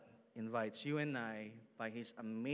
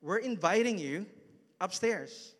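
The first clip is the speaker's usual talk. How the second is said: eager friend; 8 semitones higher, 6 dB louder than usual. Retold or imitated. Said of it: "But he goes".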